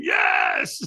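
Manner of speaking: very high-pitched, all-out yell; retold scream. Firewalker throws hands up answering trainer, full scream. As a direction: scream it in peak state. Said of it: "I scream".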